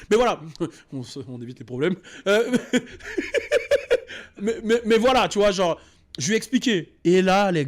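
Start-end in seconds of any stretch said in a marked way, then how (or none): 2.6-4.3 embarrassed laugh. Real, not acted. Laughs at his own confession.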